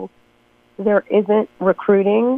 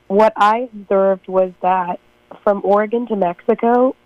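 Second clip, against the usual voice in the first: ordinary talk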